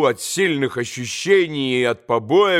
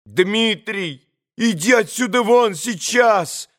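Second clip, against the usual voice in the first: commanding cry; 5 semitones higher than usual. A narrator's reading. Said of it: "властно вскрикнул Алёша".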